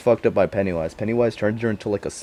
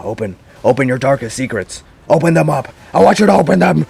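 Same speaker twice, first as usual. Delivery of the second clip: building from a sly demand to a loud, higher monster voice; growing creepier and louder as the clown presses; evil clown voice swelling into a loud, higher roar; menacing Pennywise; 5 semitones higher, 7 dB louder than usual. Retold or imitated.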